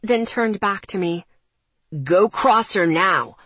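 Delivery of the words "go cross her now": loud, slow furious command; angry order. Acted. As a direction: order her coldly.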